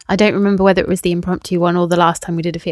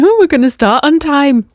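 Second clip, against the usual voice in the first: smug imitation, pitched up; mock smug; 6 semitones higher, 3 dB louder than usual. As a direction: mimic a self-satisfied boast, higher than usual. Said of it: "sit there all smug going".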